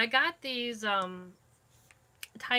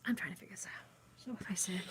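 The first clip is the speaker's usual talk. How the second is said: breathy repeated whisper; 7 dB quieter, faster than usual. Shown as a demonstration. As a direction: say it again under your breath.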